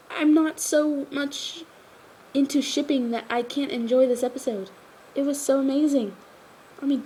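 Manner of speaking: high-pitched, worked-up enthusiasm; real animated fan fervor; emphatic fan gushing. Real, not acted. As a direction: insist on it with an excited, swooping high voice.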